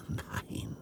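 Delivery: barely voiced no; hushed whisper. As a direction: breathe a soft no. Hushed denial.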